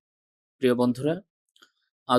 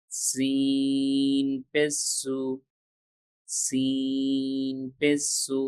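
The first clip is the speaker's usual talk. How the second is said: very slow, each syllable drawn out like a chant; slow recitation drill; much slower than usual. Shown as a demonstration. Teacher reciting Arabic letters slowly for students to repeat. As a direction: stretch every letter name slowly for the learners.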